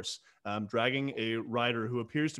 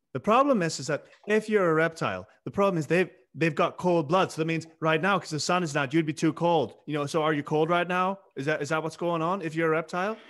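put-on higher, slightly louder boy's voice; earnest little boy; 4 semitones higher, 4 dB louder than usual. Boy questions stranger about reptiles, earnest little boy. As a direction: reason it out like an inquisitive child. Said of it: "Jack says".

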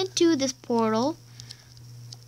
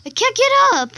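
much higher, louder stuck wail; desperate shout; 11 semitones higher, 9 dB louder, faster than usual. Real, not acted. Kid stuck in lava, desperate loud wail.

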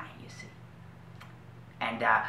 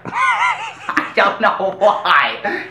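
laughing; 8 semitones higher, 5 dB louder, much slower than usual. Acted. Laughing while telling.

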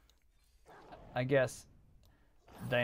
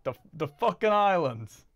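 louder mock Italian accent, higher; Italian waiter accent; 6 semitones higher, 9 dB louder than usual. Retelling a stand-up bit, playful Italian waiter accent. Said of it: "something your Italian waiter would talk about".

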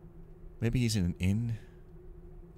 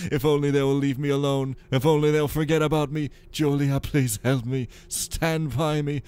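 louder, hammed-up anguished husband reading his diary; dramatic diary reading; 5 semitones higher, 5 dB louder than usual. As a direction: read it like a tormented nobleman's confession.

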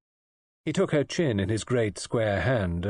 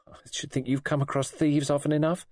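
scornful; 7 semitones higher than usual. A narrator's reading. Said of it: "suddenly and scornfully".

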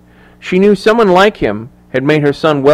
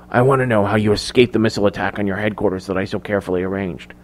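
soft, controlled interrogation; hushed, cold villain's questioning; quiet menace; 3 semitones lower, 7 dB quieter, faster than usual. A narrator's reading. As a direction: demand answers in a lowered voice. Norn menacingly interrogates Clark.

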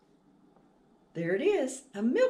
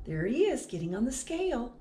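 usual speaking voice

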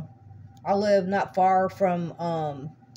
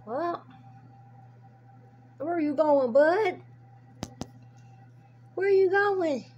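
talking to a pet; 9 semitones higher than usual. Real, not acted. Coaxing a baby possum at night.

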